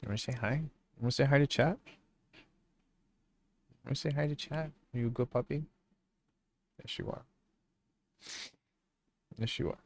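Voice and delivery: soft, high cooing at the puppy. Real, not acted. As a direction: coo softly to a dog. Talking to the dog.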